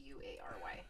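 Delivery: letters breathed out in a whisper; whispered spelling. Real, not acted. Host whispering the spelling to her co-host, whispered.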